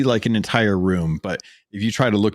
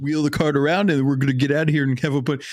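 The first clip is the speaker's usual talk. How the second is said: higher, breezy, overconfident chatter; carefree chatter; 6 semitones higher than usual. Retold or imitated. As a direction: play it loose and unbothered before the scare. Carefree player chatting in game.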